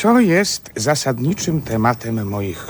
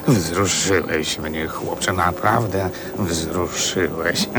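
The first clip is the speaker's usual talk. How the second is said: low, slow and hushed, genuinely moved; touched; 8 semitones lower, slower than usual. Acted. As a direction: let the emotion soften and slow the words. Flaubert touched by boy's words.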